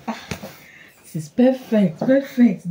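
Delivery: high, loud delighted approval; thrilled. Real, not acted. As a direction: exclaim it with mounting delight. Thrilled the gift fits her.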